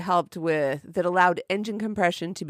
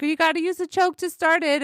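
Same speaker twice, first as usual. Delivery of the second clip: aunt voice; 11 semitones higher, 4 dB louder than usual. Retold or imitated. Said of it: "And she's like".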